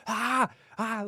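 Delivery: high, loud enraged shouting; furious yelling. Retold or imitated. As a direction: scream it like you want to kill. Dad raging down the phone.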